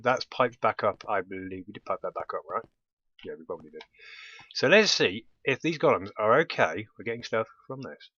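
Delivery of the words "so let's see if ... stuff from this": ordinary talk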